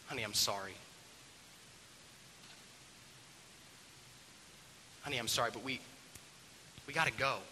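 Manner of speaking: soft, hesitant mother's apology; quiet, tender coaxing with long pauses; gentle apology. Acted. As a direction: tread carefully with an upset son. Mom gently approaches upset son.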